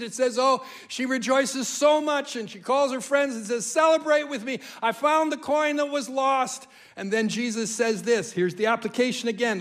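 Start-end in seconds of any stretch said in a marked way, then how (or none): 3.6-6.7 1 joyful call. Acted. Woman in parable calls friends.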